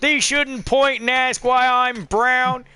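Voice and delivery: high, slow, mock-offended scolding; uber douchey outrage. Offended stranger lecturing a parent about manners, mock outraged. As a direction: draw it out in hammed-up indignation. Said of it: "people that are just uber douchey".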